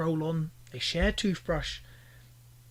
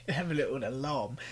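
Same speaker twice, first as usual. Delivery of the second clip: ordinary talk, nothing marked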